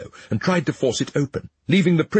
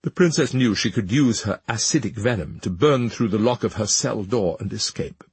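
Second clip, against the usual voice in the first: ordinary delivery, nothing marked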